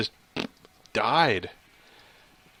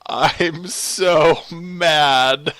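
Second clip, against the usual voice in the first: loud, high, very slow moan; exasperated wail; 5 semitones higher, 8 dB louder, much slower than usual. Real, not acted. Player venting after dying, loud drawn-out wail. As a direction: stretch every word in frustration. Reacting to game death.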